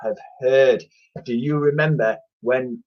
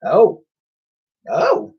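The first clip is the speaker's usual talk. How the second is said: agreeing; 3 semitones higher, slower than usual. A narrator's reading. Boy agreeing with the twins. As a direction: play along, playfully. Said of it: "agreed Ned".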